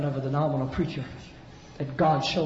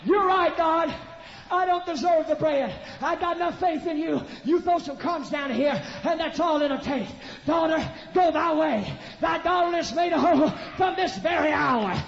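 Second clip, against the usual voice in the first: loud, high, ringing sermon shout; climbing Pentecostal shout over the music; full-force preaching; 12 semitones higher, 4 dB louder than usual. Acted. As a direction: preach it to the rafters.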